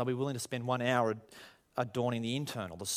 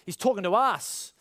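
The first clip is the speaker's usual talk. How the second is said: relieved introvert; 9 semitones higher, 6 dB louder than usual. Retold or imitated.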